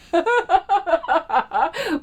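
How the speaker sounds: high, helpless giggling; real laughter. Real, not acted. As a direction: burst out in high-pitched laughter. Laughing at planned sound effect.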